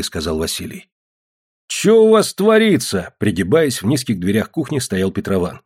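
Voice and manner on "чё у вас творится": high, loud, drawn-out question; booming demand. A narrator's reading. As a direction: call it out on walking in. Petrovan calls from the doorway.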